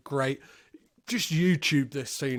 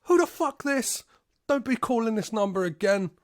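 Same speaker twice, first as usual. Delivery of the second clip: much higher, fast, mock sassy wife voice; hammed-up angry wife slamming the phone; 6 semitones higher, faster than usual. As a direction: squawk it back like an outraged wife. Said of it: "And she's like".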